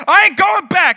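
loud, high defiant yell; mock defiant scream. Mouthy friend screaming at the cop, mock defiance. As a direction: yell it like a movie tough guy. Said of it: "he's screaming at the cop".

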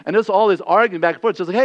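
normal talking voice

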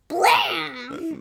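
mock explosion. Imitating a battle comic's noises, explosive blam. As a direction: punch it out like a comic-book blast.

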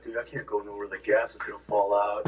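retold mope, soft and low; mock a sulking driver, voice dropped; mopey. Driver moaning to his friends about the gas, mopey. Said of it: "he's, like, real mopey about it".

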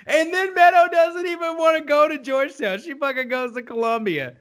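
high-pitched, laughing through the words; amused, laughing delivery. Real, not acted. Recapping a favorite hilarious scene, laughing and high. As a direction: retell it while cracking up.

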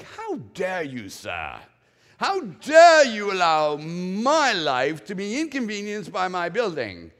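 hammed-up how-dare-you; mock outrage. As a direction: huff like an offended gentleman. Acted outrage at God.

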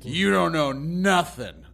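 put-on a drawn-out scoff at a clueless kid; mocking boss. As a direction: lean on don't like a scornful boss. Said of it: "He was like".

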